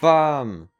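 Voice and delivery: loud, high burst of victory; triumphant shout. Real, not acted. Gamer yelling as the boss goes down, loud triumphant shout. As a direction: slam the word out like a knockout blow.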